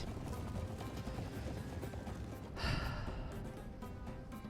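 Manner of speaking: soft, drawn-out sigh; long sigh. Real, not acted. Gamer facing a losing battle, long soft sigh. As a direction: let out a long, quiet breath. Enemy won't rout, battle looks lost.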